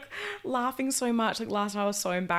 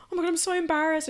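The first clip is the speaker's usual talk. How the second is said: excited gush; 9 semitones higher, 5 dB louder, faster than usual. Retold or imitated. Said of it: "And I was like".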